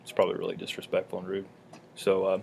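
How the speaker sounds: talking while crunching a carrot. Real, not acted. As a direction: speak through a mouthful of carrot. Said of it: "excuse me crunching on my carrot".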